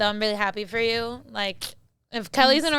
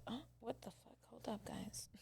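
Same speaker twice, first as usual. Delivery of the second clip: surprised whisper; 27 dB quieter than usual. Real, not acted. Interrupts herself, seeing something.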